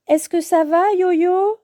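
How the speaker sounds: high-pitched concerned question, rising; worried. A narrator's reading. Friends see Yo-Yo lose balance, worried. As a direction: check anxiously on a wobbling friend. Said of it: "s'inquiètent ses amis".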